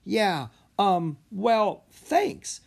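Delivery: startled, halting thanks; surprised. Acted. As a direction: caught off guard, stammering. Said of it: "Rory looked surprised".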